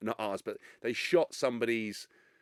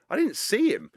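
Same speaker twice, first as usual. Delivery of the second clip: raised, higher and louder protest; indignant retort; 8 semitones higher, 3 dB louder than usual. Retold or imitated. Defending himself to critics who blame him, indignant. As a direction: snap back defensively at the accusers.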